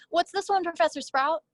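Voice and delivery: high, eager little brother; little boy voice. Retold or imitated.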